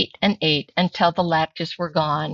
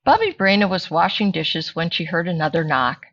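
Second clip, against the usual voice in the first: ordinary delivery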